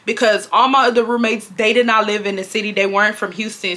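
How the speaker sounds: ordinary talk, nothing marked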